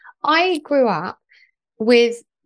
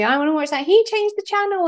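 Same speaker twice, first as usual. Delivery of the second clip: whiny sibling; 8 semitones higher than usual. Retold or imitated. Squabbling sibling quoted.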